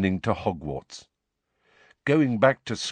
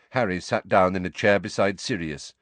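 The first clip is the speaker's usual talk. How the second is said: usual speaking voice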